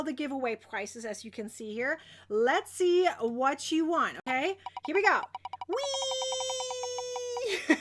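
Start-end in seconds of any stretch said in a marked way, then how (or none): 5.7-7.8 long high squealed whee; playful whee. Real, not acted. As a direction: squeal along as the wheel spins. Spins the giveaway wheel.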